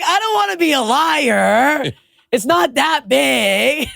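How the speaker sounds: high, drawn-out comic wail; mock whining protest. Woman confessing to her friend about exaggerating onstage, mock whining protest. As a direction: stretch every word high, playing up the protest.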